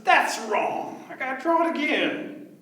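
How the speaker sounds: worked-up little rabbit; frustrated rabbit. Acted. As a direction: fuss over a botched drawing.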